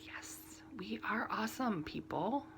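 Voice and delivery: whispered, proud aside; playful whispered pride in the crafting community; mock whisper. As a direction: whisper it like a smug secret. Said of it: "and I'm like".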